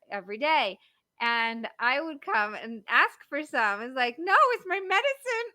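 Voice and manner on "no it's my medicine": put-on high, squeaky old lady voice; grandmother voice. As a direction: play a stern little grandma guarding her treat. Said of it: "my grandmother had a bar".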